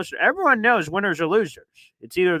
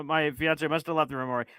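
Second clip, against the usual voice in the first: usual speaking voice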